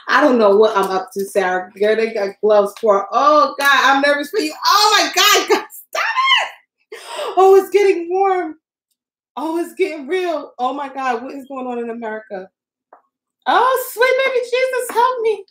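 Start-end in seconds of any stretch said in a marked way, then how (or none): 4.7-15.4 real panic. Real, not acted. Crafter panicking over the hot cup, high pleading cry. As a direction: beg for help as it heats.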